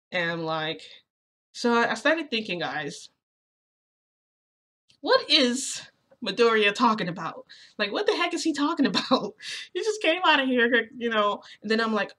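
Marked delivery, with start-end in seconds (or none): 5.0-10.9 high, loud, incredulous questioning; baffled disbelief. Acted. Narrator wonders what Midoriya is talking about, baffled. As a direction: puzzle aloud with a touch of laughter. Puzzles aloud over Midoriya's words.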